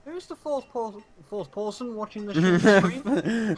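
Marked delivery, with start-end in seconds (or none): 2.3-3.5 much louder surprised stream?. Real, not acted. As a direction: blurt it out loud. Rising loud question.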